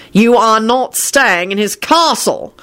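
high, slow, forceful roar. A narrator's reading. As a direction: bellow the order at him. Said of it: "Shacklebolt roared".

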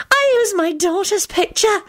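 high, slow boast; high-pitched, self-pleased declaration; proud announcement. A narrator's reading. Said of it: "Sylvia announced proudly".

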